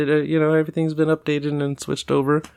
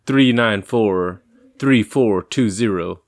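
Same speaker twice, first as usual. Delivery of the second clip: slow, clear number reading; 2 semitones lower, slower than usual. Real, not acted. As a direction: spell out digits slowly. Slowly reading phone number.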